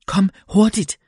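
a women's urgent call for help. A narrator's reading. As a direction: shout it across the park in the rain. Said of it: "råbte de".

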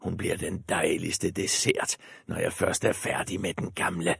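soft greedy wolf aside. A narrator's reading.

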